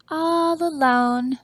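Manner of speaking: drawn out slowly, a pitying lonely sigh of words; sad and lonely. Acted. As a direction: let it hang, slow and sorry for the duck. Lonely duck read to children.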